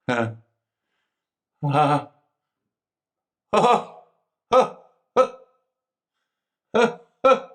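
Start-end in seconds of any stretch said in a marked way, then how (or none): 1.6-7.5 short loud anguished ahs; pained cries. Acted. Prisoner reliving the accident, crying out in pain. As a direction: gasp out the pain of the memory.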